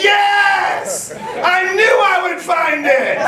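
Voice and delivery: triumphant shout. Retold or imitated. Pastor finding the lost ring in the water, triumphant shout. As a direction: yell it out like you just won.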